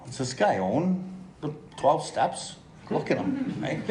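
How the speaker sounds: scoffing disbelief, pitch jumping way up on look at him; mock incredulous. Newcomer at a meeting scoffing to his dad about the well-dressed speaker, mock incredulous.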